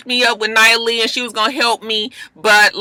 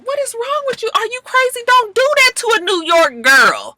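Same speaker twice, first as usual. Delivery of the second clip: higher, startled, mock-appalled; shocked scold; 12 semitones higher than usual. Jonathan to Sydney, appalled she bucked at Nia, high-pitched shock. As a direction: play it like a gasping, appalled onlooker. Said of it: "Jonathan is looking at her like".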